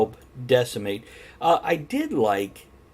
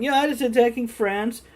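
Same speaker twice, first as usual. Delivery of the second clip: high-pitched cartoon impression; mock alarm in a high voice; cartoon quote; 11 semitones higher than usual. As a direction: put on a high cartoon voice, rising at the end. Quoting an internet cartoon from memory.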